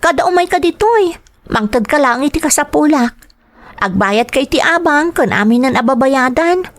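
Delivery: fast, high, rising angry rant. Acted. Mistress rants at Meliton about money, angry and fast.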